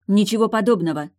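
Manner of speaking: loud high indignant denial; indignant outcry. A narrator's reading. Anya indignantly denying the charge. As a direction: reject it flatly. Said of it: "возмутилась Аня".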